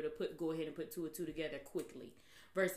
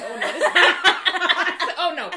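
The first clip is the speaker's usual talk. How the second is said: loud, high, laughing outburst; retold alarmed refusal; 5 semitones higher, 16 dB louder than usual. Telling friends how she reacted to a movie preview, loud alarm. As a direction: replay your horrified reaction, loud and laughing.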